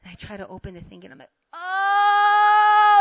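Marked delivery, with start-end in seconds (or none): 1.5-3.0 long, loud, high wail; hammed-up groan as the cramp strikes; pained groan. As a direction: cry out in mock agony. Cramp returns, groans again.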